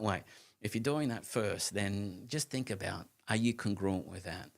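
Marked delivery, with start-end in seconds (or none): none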